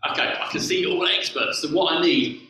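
normal talking voice

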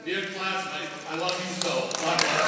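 heartfelt farewell. Real, not acted. Old man ends speech to classmates, heartfelt. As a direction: send them off with love. Closing dedication.

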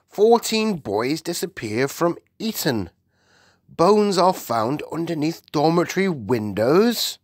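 high, emphatic reading aloud; shocked Queen. Acted. The Queen reads the headlines to her maid, shocked. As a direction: read out each headline with mounting alarm.

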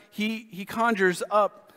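ordinary talk

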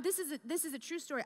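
ordinary delivery

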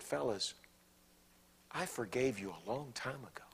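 soft, sweet, whispered forgiveness; gentle whisper. Acted. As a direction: hush it like a tender secret. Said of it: "There was sweetness in his voice".

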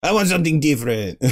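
hammed-up fake Italian accent that sounds French; bad Italian accent. Cook replaying the baron's demand in a badly faked Italian accent. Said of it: "I don't do an Italian accent".

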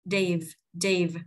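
"Div" closed on a buzzing V; voiced V ending. Shown as a demonstration. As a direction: separate model takes of "Div". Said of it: "đẩy luồng hơi cổ họng rung".

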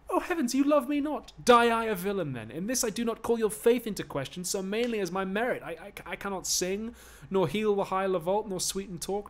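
high, loud jealous anguish; anguished outburst. Acted. Lover fearing rivals, high loud anguished outburst. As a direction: burst out in jealous despair.

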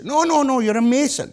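high, loud rapid-fire denial; mock-exasperated father; exasperated insistence. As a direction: play a dad overruling his son.

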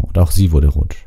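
ordinary delivery, nothing marked

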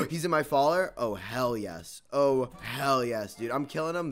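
usual speaking voice

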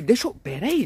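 ordinary delivery

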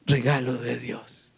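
low, weighty answer; solemn affirmation. Shown as a demonstration. Preacher answers what a daughter is, solemn affirmation. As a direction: drop deep and state it firmly.

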